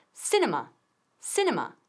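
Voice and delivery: "Cinema" with a short clipped I, SIN-e-ma. Shown as a demonstration. Teacher models the English word for students. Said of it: "Con una I corta".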